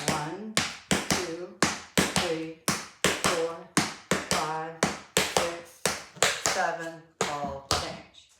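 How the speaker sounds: loud rhythmic counting; counting out steps. Shown as a demonstration. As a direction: call the counts like a tap teacher. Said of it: "So it will go like this".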